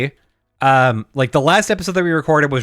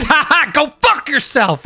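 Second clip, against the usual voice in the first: high, laughing jeer of a clerk; gleeful mocking clerk voice; 11 semitones higher than usual. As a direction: laugh it out like a smug clerk telling him off. S1 voices the GameStop guy.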